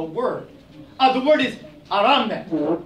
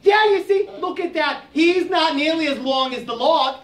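Aso gloating; 7 semitones higher than usual. Acted.

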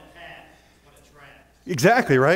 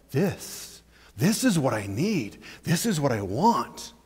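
mesmerized hush; 3 semitones lower, 6 dB quieter, slower than usual. Acted. Fish mesmerized by the bait.

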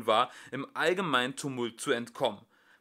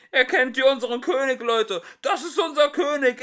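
rousing shout; 12 semitones higher, 10 dB louder than usual. Acted. Executioner rousing the crowd.

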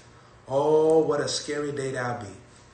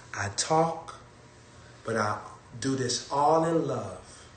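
ordinary talk, nothing marked

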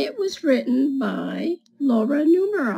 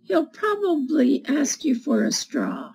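put-on reading to children; 3 semitones higher than usual. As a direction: read it like storytime for little kids. Reads picture book to children.

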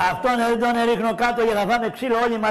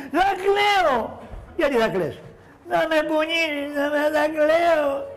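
put-on whiny child; 5 semitones higher than usual. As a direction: plead like a spoiled kid threatening tears. Adult plays a whiny child.

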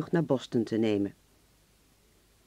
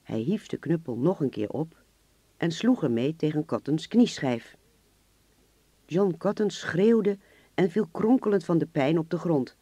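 usual speaking voice

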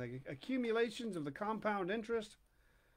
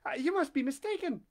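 much higher, hesitant society lady; stammering lady; 8 semitones higher than usual. Acted. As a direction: stammer politely, caught off guard. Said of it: "and stammered".

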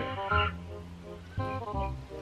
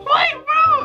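shocked shout. Real, not acted. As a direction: yell it out in disbelief. Reacts to something shocking.

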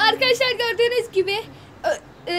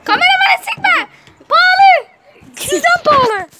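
shouting; 8 semitones higher, 7 dB louder than usual. Real, not acted. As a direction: yell a sharp warning. Yelling at brother spraying camera.

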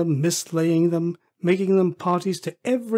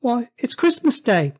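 high, amazed answer; boy's voice; 8 semitones higher, 5 dB louder than usual. Acted. Boy telling Scrooge the day, high-voiced. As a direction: state the obvious as a young lad. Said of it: "replied the boy".

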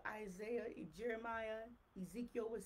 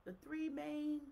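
ordinary talk, nothing marked

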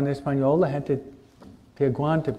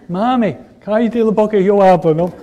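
put-on loud, high, bossy kid voice; bossy child; 5 semitones higher, 8 dB louder than usual. Child bossily tells mom to hush, loud and high. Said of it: "tu hijo dice".

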